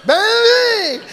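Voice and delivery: high, loud call through a mask; muffled nurse calling out. Retold or imitated.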